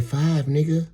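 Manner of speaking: put-on high, loud child's retort; imitating sassy kid. Five-year-old telling her age, imitated brash high voice. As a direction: throw it back as a brash little kid.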